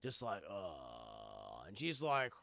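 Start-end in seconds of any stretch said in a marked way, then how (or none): 0.5-1.6 creepy groan. Retold or imitated. Hand rubbing under tarp.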